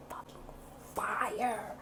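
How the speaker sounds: drawn-out whispered intensity; whispered emphasis. Real, not acted. Describing the pain on waking, whispered emphasis. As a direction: drop to a whisper for the punch word. Stressing how much the foot hurt.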